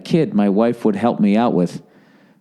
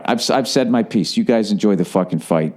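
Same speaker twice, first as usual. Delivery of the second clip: normal talking voice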